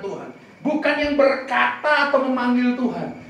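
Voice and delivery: loud, slow, emphatic preaching; preaching at full force. Acted. Preacher exhorting the congregation, loud slow emphatic preaching. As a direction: drive each line home to the congregation.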